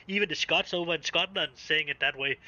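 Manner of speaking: ordinary talk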